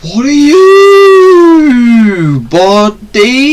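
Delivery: slow, loud, high disbelief; stunned high question. Real, not acted. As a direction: draw it out, shocked and high. Confronting a creature in game.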